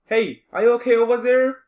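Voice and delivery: loud call to someone far off. Acted. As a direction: shout over to him. Calling out across distance.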